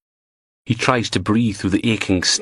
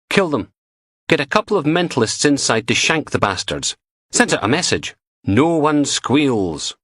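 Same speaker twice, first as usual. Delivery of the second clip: a higher woman's voice, flat and ruthless; blunt young woman; 7 semitones higher than usual. Acted. Lay it out coldly, slowing hard on the last line. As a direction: Butler answering Logan's gangster question, ruthless, with a higher voice. Butler voiced, cold answer.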